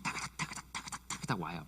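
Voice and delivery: soft clip-clop of chariot horses, whispered; imitated galloping hooves. Shown as a demonstration. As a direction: mimic hooves clattering, hushed. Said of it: "똑같죠?".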